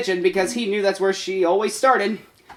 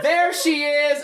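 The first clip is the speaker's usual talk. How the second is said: put-on kids screaming; 7 semitones higher, much slower than usual. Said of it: "a bunch of kids screamed".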